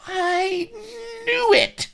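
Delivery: very high, loud woman's yelp; yipping. A narrator's reading. Vanity's suspicion is confirmed, high outraged yip. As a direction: yip it out indignantly. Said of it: "yipped Vanity".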